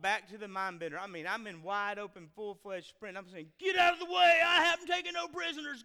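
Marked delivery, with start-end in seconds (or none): 3.6-4.4 high, loud shouted warning; frantic shout to clear a path; retold scream. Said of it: "screaming people". 4.4-5.8 loud and high, still shouting; shouting while running; retold yell. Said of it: "screaming people".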